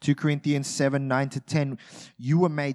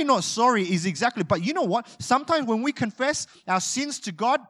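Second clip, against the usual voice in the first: ordinary talk, nothing marked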